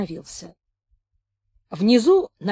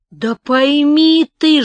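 slow, pleading shout, louder; plaintive shout; 7 semitones higher, 8 dB louder, much slower than usual. A narrator's reading. Captain pleading loudly with the stubborn old man, plaintive shout. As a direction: cry out in helpless frustration. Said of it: "жалобно закричал капитан".

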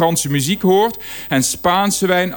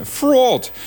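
fantasy character name; 9 semitones higher than usual. Pretend. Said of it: "een personage uit een Harry Potter boek".

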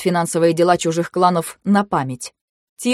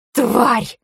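snarled curse; 6 semitones higher than usual. Acted. Haley curses Blau in rage.

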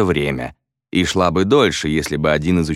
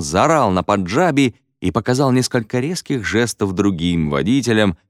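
ordinary delivery, nothing marked

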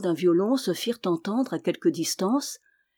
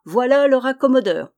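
louder, higher calling out; raised-voice tradesman's cry; street cry; 5 semitones higher, 7 dB louder than usual. A narrator's reading. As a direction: call out your trade to the street. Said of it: "en criant".

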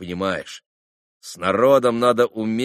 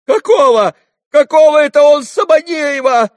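very high, very loud frenzied question; frantic repeating; 12 dB louder than usual. A narrator's reading. Lad waving his arm at the traders, frantic. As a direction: shout it over, beside yourself. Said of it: "всё неистово повторял парень".

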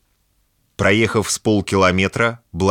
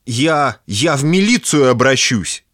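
indignant threat; 6 semitones higher, 4 dB louder than usual. Acted. Threatening the strange blonde.

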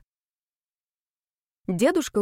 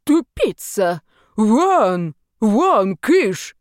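put-on louder furious old man; angry yelling; 2 semitones higher, 6 dB louder, much slower than usual. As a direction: drive him off with shouts. Old man chases ghost away. (гневные крики)

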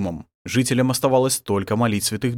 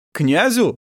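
high, louder questioning word; astonished young man; surprised; 9 semitones higher, 5 dB louder than usual. A narrator's reading. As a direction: echo it in surprise. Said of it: "удивлённо переглянулись".

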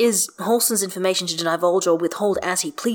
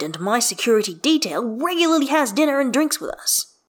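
higher, louder indignant rebuttal; defensive; 7 semitones higher, 4 dB louder than usual. Acted. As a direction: argue back insistently, affronted. Defensive rebuttal in acted argument.

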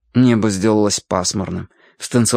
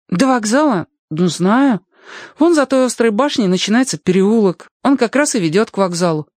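put-on high-pitched boy's voice; helpful boy; 11 semitones higher than usual. Boy directs Alyoshka to the station, high helpful voice. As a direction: point out the way in a high voice.

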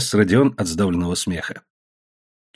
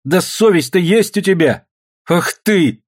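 higher, indignant reproach; indignant scolding; 7 semitones higher, 4 dB louder, slower than usual. Acted. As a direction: shame him in a raised pitch. Daughter shames and grabs father.

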